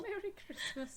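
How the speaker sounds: high, soft, small voice; faint half-hearted cheer; mock forlorn echo. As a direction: echo the greeting meekly. Echoes the forlorn festive greeting.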